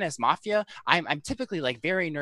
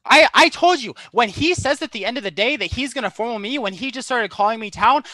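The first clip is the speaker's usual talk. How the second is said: loud defensive; 7 semitones higher, 8 dB louder than usual. Real, not acted. Defending his play loudly.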